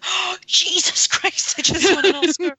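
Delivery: high, slow, breathless astonishment; stunned disbelief. Retold or imitated. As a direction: gasp it out in shock. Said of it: "had that look on his face of".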